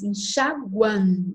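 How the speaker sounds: enxaguan very slow, split by syllable; nasal diphthong demo. Shown as a demonstration. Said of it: "Ele vai falar também dos ditongos nasais". (enxaguan bem devagar, com final nasal)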